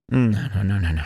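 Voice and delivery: ordinary talk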